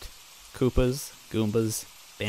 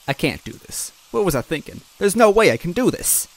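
rising from worry to a near wail; shaky panic; 8 semitones higher, 7 dB louder than usual. A narrator's reading. Luigi panicking to himself before Goombella leaves, shaky. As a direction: voice climbing into frightened panic. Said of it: "I replied shakily".

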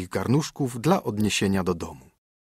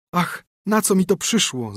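higher, a mild tearful wail; lamenting; 8 semitones higher than usual. A narrator's reading. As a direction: cry out in dismay, understated. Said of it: "zawołała".

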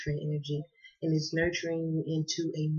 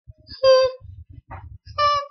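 big laugh; 7 dB louder than usual. Real, not acted. Laughing burst after retrograde line.

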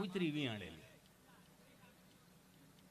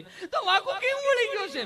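much higher, much louder hammed-up panic screams; panicked shrieking mother; 14 semitones higher, 10 dB louder than usual. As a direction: shriek for help like the child is dying. Panicking mother asking again. (ગભરાટમાં ખૂબ ઊંચી, મોટી રાડો)

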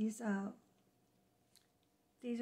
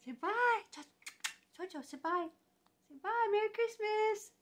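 high, sing-song coaxing; sweet high-pitched goodbye; 9 semitones higher than usual. Real, not acted. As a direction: coax a little one to wave goodbye. Coaxing JoJo to say bye.

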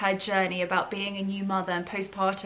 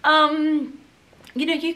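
getting emotional; 5 semitones higher than usual. Real, not acted. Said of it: "I'm getting emotional again".

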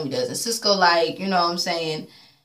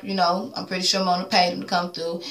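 ordinary talk

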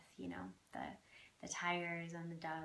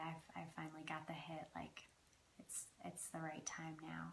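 quick confiding whisper; 9 dB quieter than usual. Real, not acted. Telling viewers the time has come, whispered. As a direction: whisper it like a secret realization. Confiding the moment felt right.

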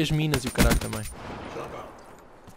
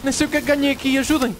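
panicking gamer; 10 semitones higher than usual. Real, not acted. Asking for help mid-fight.

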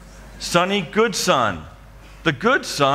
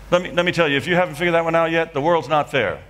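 usual speaking voice

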